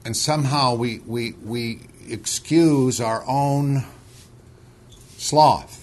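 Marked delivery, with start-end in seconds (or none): 5.2-5.8 sloth punched out louder and higher after a pause; stress on sloth. Real, not acted. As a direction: land hard on the single word sloth. Sermon point hits the word.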